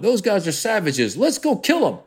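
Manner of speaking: high, riled-up jingoist voice; mocking bloodthirsty crowd. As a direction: mimic a crowd whipped into fury, rising high. Mob incited against Arabs.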